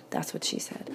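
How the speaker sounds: breathy whispered punchline; whispered aside. Acted. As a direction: toss it off in a whisper. Joke aside after a punchline.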